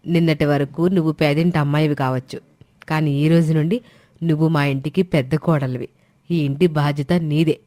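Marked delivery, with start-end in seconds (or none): none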